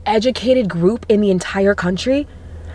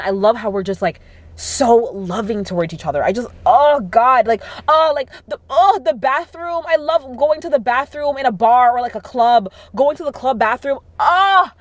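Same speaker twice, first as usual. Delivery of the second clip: ordinary talk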